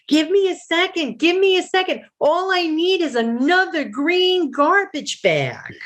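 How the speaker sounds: high, strained quote; squeaky high voice. Retold or imitated. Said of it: "I'm like".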